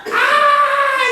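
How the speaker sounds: high, loud delighted shriek; the whole family shrieks at Homer's butt, a loud mock laugh; big laughing shriek. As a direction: let out a loud hammed-up whoop of laughter. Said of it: "the whole family is just like".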